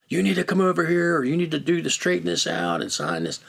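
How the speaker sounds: high-pitched mock demand; imitated angry yelling. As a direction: play the irate caller giving orders. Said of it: "he's yelling and screaming".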